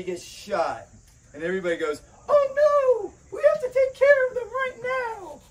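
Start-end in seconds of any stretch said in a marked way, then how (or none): 2.3-5.4 shrill, much higher mock panic, almost crying. Mocking TV characters who panic when someone is shot, shrill falsetto wail. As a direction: ham up a frantic movie character wailing over a wounded friend.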